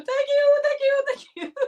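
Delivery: mock high voice. Reading live chat, mock high voice.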